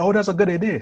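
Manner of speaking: high, bright mock praise; mock fake enthusiasm. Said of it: "if they have an idea".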